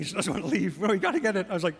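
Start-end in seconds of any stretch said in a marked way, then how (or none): 0.8-1.5 resisting mother. Retold or imitated. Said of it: "She's, like, resisting".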